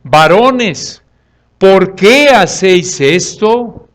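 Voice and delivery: loud, slow, emphatic appeal; acted crying out. Preacher voices Paul shouting at the crowd, loud protest. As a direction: cry out in protest, stretching each word. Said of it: "y gritan".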